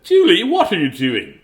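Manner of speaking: high, loud, slow questioning; stretched-out motherly demand; alarmed mother. Acted. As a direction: call out like a scandalised mom. Mother confronts Julie in story.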